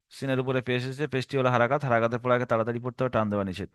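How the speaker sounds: low, soft, fast recital; mimicking a student's rattled-off drill; students' wrong method. Shown as a demonstration. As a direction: gabble the rule under the voice. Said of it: "এটা একটা রং পদ্ধতি".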